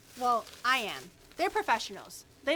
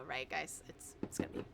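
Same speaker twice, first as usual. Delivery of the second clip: unvoiced, much softer whisper; 10 semitones lower, 12 dB quieter than usual. Real, not acted. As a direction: whisper it aside. Whispered aside to chat.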